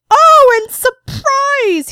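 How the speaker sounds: high, loud, drawn-out surprise reveal; hammed-up "ta-da" to a crowd; mock surprise announcement. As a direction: act out a big excited surprise. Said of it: "be like".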